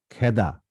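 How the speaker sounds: খেদা as "khæ-da"; open 'æ'. Shown as a demonstration. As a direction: say খেদা with a wide first vowel. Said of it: "আমরা বলি কি?". (খেদা-র উচ্চারণ "খ্যাদা")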